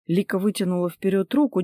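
usual speaking voice

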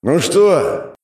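loud mocking challenge; taunting. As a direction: taunt the enemy army. Monster taunts the enemies.

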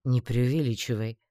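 mildly stern reproof; understated, controlled scolding. A narrator's reading. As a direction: check the complainer firmly. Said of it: "строго одёрнул меня маг".

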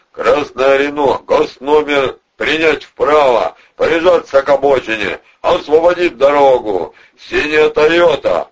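megaphone shouting. A narrator's reading. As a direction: bellow orders at traffic as through a megaphone. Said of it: "выкрикивал Протасов в матюгальник". (выкрикивать как в матюгальник)

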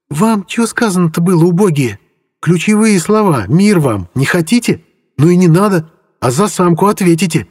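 high, furious scolding; angry girl. A narrator's reading. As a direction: tear into the bandits, high and sharp. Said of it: "Орхи всерьёз обозлилась". (злая, визгливая отповедь)

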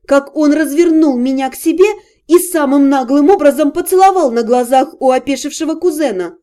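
ordinary delivery

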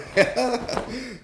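a burst of high cackling laughter; high delighted cackle. Acted. Old patient cackles at the nurse's joke.

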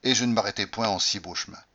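ordinary delivery, nothing marked

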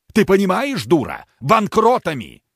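yelling in fury; shouting. A narrator's reading. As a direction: bellow it at the wife. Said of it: "Не ори на меня".

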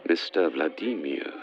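usual speaking voice

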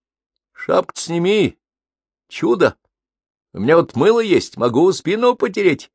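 put-on high, lively young woman; playful, teasing girl in the shower. Svetka voiced in story.

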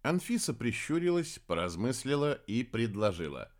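plain reading voice

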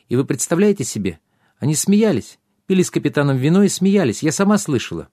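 high, quick, scandalized princess; outraged princess. A narrator's reading. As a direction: tell it like a shocked princess.

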